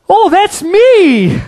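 high, loud, slow cry of "that's me"; mock congregant. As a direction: play a thrilled believer. Congregant answers excitedly.

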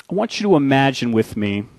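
ordinary talk

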